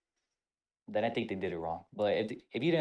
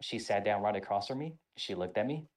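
ordinary talk, nothing marked

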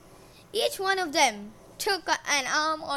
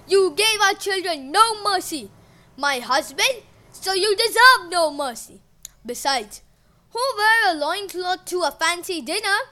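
loud, higher scornful voice; scornful anger; 5 semitones higher, 9 dB louder than usual. A narrator's reading. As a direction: condemn him coldly. Gaia condemning husband.

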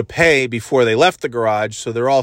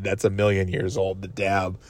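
ordinary delivery

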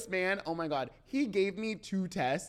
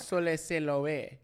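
"So laissez la vie" slowed down in a mock Spanish accent; slower than usual. Shown as a demonstration. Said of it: "with, like, a Spanish accent".

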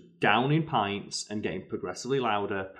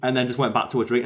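ordinary talk, nothing marked

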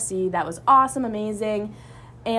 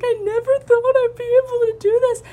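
retold sobbing; 7 dB louder than usual. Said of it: "like, sobbing".

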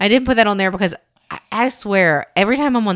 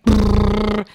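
buzzing imitation; 3 semitones higher, 4 dB louder than usual. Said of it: "It sounded like I had a butterfly".

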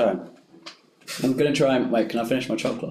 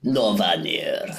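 failed Spanish accent, slow; bad Spanish accent; 7 semitones lower, slower than usual. Shown as a demonstration. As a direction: try a Spanish accent and miss. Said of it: "That was not. Russian".

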